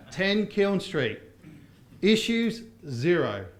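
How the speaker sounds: Ten Kiln Street drawn out very slowly; slow, determined read. Shown as a demonstration. Teacher reads the cleaner's text very slowly. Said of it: "I'm determined".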